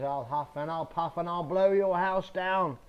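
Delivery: hungry wolf losing his temper; angry wolf. Acted. Angry hungry wolf threatens the brick house, loud. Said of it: "he's very angry and very hungry".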